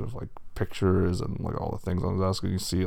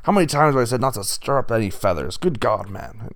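loud, raised-pitch boss tirade; enraged bull; 6 semitones higher, 7 dB louder than usual. A narrator's reading. Bull boss dresses down the wolf, enraged. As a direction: bellow the name, then scold at full pace. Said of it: "a large bull who looks enraged".

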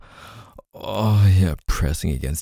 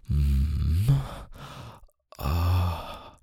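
normal talking voice

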